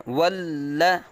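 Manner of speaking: ordinary talk, nothing marked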